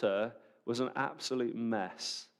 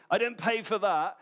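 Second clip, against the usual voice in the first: indignant complaint; 8 semitones higher, 4 dB louder than usual. Retold or imitated. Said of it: "And they're like".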